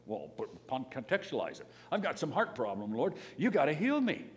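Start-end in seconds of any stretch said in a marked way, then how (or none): 1.9-4.2 mock demanding prayer.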